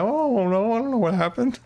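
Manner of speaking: high, put-on helpless voice; mock baffled reply; retold bewilderment. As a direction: play clueless in a high voice. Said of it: "I'm like".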